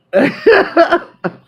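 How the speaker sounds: loud high laugh; real loud laugh. Real, not acted. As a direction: burst out laughing. Laughs at God's reply.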